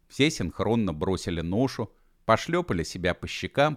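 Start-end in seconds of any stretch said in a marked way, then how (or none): none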